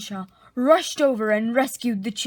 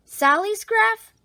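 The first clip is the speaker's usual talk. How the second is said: plain reading voice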